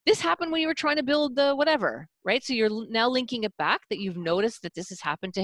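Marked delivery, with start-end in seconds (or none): 0.0-2.1 higher, lively show of sympathy; match his frustration; summarizing demo. Shown as a demonstration. Parenting coach demonstrating summarizing to the mother, high and lively. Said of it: "You could summarize".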